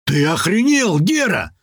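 ordinary delivery, nothing marked

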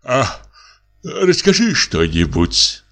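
yawned request; yawning. Acted. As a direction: say it through a yawn. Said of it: "зевнул муж".

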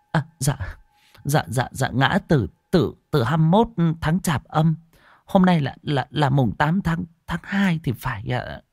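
high, stammering and flustered. Acted. Wife stammering out the dates to the monk, flustered and high. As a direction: trip over the words, counting the days in a panic. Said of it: "Bà Thơm luống cuống".

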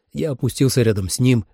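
ordinary delivery, nothing marked

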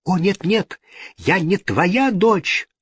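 high-pitched anguished protest; horrified denial. A narrator's reading. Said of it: "отступая с ужасом".

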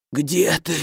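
weak, rasping call from a dying man; hoarse dying voice. A narrator's reading. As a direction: croak it out, barely alive. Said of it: "неожиданно прохрипел умирающий человек".

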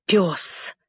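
low-key, understated fury; gritted-teeth anger. A narrator's reading. Templar answers Athelstan's insult, understated gritted-teeth anger. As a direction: say it through gritted teeth, contained. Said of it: "заскрежетав зубами".

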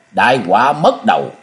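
ordinary delivery

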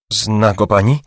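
ordinary delivery

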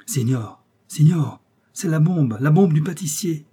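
loud, high announcement; servant calling master. Acted. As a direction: call out excitedly to your master.

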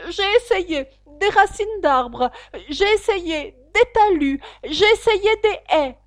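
high, loud, slow bird's lament; plaintive pigeon. Acted. Pigeon complaining past Alice, high plaintive voice. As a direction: list each failure with mounting despair.

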